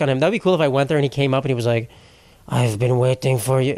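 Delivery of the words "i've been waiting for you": put-on slow turtle voice with a Japanese accent; mock Japanese accent. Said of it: "He speaks with a Japanese accent".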